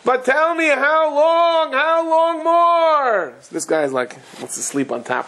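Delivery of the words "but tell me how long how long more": high, slow crying wail to heaven; begging heaven through tears, very high and slow; wailing plea. Retold or imitated. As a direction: draw out each word like a sob. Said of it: "And he's crying".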